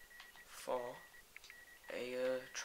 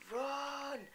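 high panicked shout; sudden high yell; 13 semitones higher, 6 dB louder than usual. Real, not acted. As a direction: yell at yourself to flee. Fleeing the mob.

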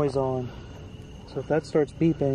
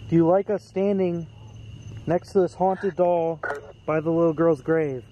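calling to a spirit; 3 semitones higher, 6 dB louder than usual. Real, not acted. Ghost hunter questions spirit by the grave, calling out. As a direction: call the question out to someone unseen.

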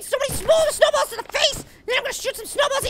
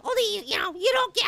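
ordinary talk, nothing marked

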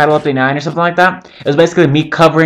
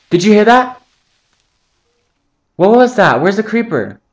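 startled high anxious questions; spooked, looking around for the threat; scared; 7 semitones higher than usual. Real, not acted. Gamer spooked by a creeper hiss, asks nervously. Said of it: "That scared the shit out of me".

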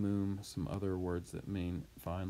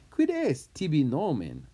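demanding name; 6 semitones higher, 7 dB louder than usual. Acted.

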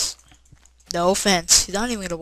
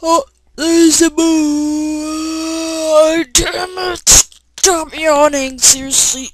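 high, loud, drawn-out yell at the game; startled yelling; 9 semitones higher, 10 dB louder, much slower than usual. Real, not acted. Gamer yelling as night falls in Minecraft, high and loud. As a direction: shout at the screen in real panic.

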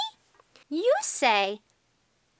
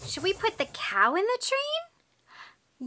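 ordinary talk

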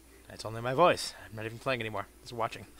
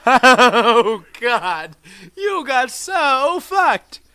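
high, loud, drawn-out crowing; gloating; 9 semitones higher, 13 dB louder, much slower than usual. Pretend. Player to beaten game enemy, loud high gloating. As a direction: crow over your victim, stretching it out high and loud.

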